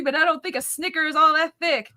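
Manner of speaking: usual speaking voice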